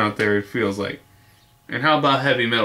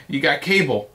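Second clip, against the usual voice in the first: ordinary talk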